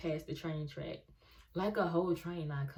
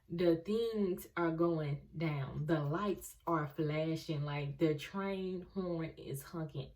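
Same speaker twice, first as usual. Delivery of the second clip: slow dramatic scene-setting; suspenseful slowed narration; slower than usual. Real, not acted. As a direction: build tension, drawing it out.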